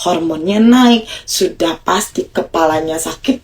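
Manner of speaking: normal talking voice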